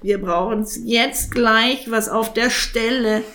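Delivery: high croaky raven, demanding; impatient raven. Acted. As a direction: insist on now.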